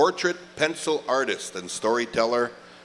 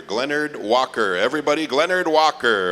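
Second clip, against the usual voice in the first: building announcer call; 3 semitones higher, 5 dB louder than usual. Acted. MC presenting the honoree to the powwow crowd, rising to a loud call. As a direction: announce him to the whole crowd, bigger each time.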